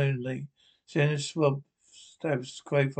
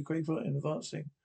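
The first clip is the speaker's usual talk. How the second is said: ordinary delivery, nothing marked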